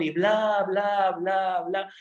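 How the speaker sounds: high singsong dismissal; mocking blah-blah. Preacher skipping Jonah's prayer, mockingly pompous. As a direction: rattle it on as empty pomp.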